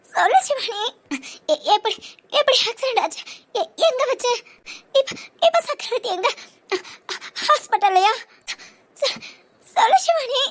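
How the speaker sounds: tearful pleading with broken, stammered questions; crying. Acted. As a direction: beg for news through sobs. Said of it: "இப்ப எதுக்கு அழுகுறீங்க?". (அழுகையோடு திக்கித் திக்கிக் கெஞ்சல்)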